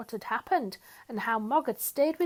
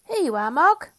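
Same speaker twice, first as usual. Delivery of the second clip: put-on higher, louder, eager child handing over a gift; bright offering voice; 6 semitones higher, 10 dB louder than usual. A narrator's reading. Nicky handing Mog her present, eager and loud. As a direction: present it with glee.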